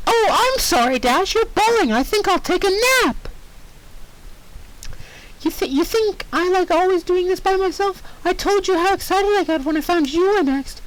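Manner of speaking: high mocking pony voice; mock the crying friend; high, snide cartoon pony; sarcastic Pinkie. Pinkie scolding Dash.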